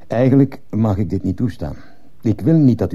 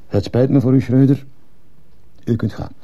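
loud, high, firm dismissal; raised voice; 7 semitones higher, 3 dB louder than usual. Acted. Principal dismisses teacher, raised firm voice. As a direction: say it forcefully, pitched up.

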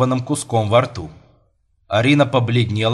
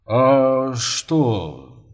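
drawn-out puzzled murmur; bewildered father. Acted. Father puzzled by his daughter's refusal, bewildered and hesitant. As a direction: wonder aloud, in no hurry.

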